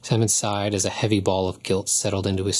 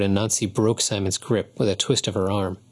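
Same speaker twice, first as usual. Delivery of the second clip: usual speaking voice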